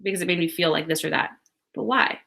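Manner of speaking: a quiet steady inner voice; calm self-talk. Pretend. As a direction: breathe out and ask yourself gently. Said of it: "take a deep breath and be like".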